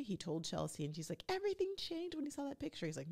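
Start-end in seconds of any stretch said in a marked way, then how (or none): 1.3-2.9 high put-on accusing voice; mock insecure girlfriend. Vlogger imitating Chelsea accusing her fiance, high and whiny. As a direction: squeak it out, playing her paranoid.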